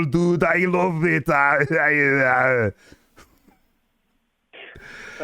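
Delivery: hammed-up Arnold-style voice; badly faked Arnold, pushed out with relish; put-on Arnold accent as a catch-all character; Funky character. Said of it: "it's always just Arnold's voice".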